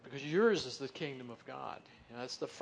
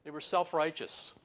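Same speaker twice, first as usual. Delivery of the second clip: ordinary talk, nothing marked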